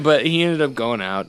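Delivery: usual speaking voice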